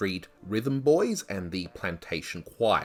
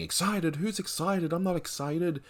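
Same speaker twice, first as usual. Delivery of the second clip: mock angry outburst; 11 semitones higher than usual. Quoting a radio judge losing his calm, mock angry outburst. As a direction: mimic a comic blow-up in a raised pitch. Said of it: "he would burst out in anger".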